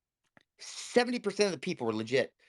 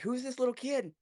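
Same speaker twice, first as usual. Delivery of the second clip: puzzled; 8 semitones higher than usual. Retold or imitated. Crew's reaction to childlike organiser, acted.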